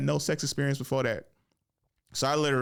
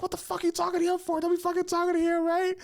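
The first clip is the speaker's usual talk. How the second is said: much higher imitation of a jealous girlfriend yelling; mock jealous yell; faster than usual. Ex scolding her new boyfriend for talking to him, much higher mock yell. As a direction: play the ex snapping, pitch way up. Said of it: "she used to yell at him".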